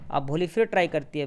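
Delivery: ordinary delivery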